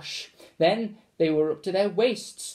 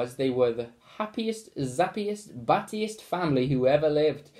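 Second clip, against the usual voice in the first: usual speaking voice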